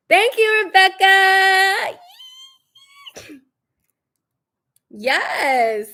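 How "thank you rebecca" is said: high, loud drawn-out thank you to Rebecca; loud sarcastic thanks. Real, not acted. Calling out Rebecca by name, loud and drawn out. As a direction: call her name out long and pointed.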